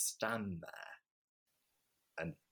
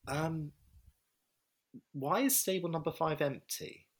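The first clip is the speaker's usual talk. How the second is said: higher, puzzled question about the empty stable; puzzled receptionist; 10 semitones higher than usual. Retold or imitated. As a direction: ask it like a confused coworker, pitched up.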